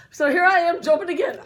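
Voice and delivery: loud breathless rush; excited shout. Retold or imitated. Hitting the next jump, a loud excited shout. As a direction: call it out as you take off.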